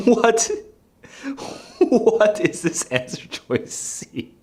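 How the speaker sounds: sudden high "What?", then slow and incredulous; stunned by an absurd answer choice; shocked disbelief. Real, not acted. Baffled by a test question.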